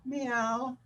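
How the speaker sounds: high, pleading little meow; a tiny cat asking for food; kitten meow. Retold or imitated. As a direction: beg like a wide-eyed cat. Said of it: "looks right up at you and says".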